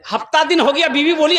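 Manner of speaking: ordinary delivery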